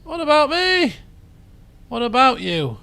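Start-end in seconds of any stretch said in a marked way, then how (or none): 0.0-0.9 high-pitched plea from someone left out; Volpus's protest. Acted. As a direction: pipe up high, playing the forgotten companion. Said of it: "Says Volpus".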